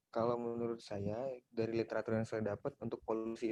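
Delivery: very faint, far from the mic; speaking almost too softly to hear; barely audible. Real, not acted. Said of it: "Suaranya terlalu kecil".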